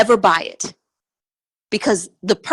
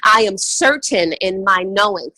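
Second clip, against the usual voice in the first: ordinary talk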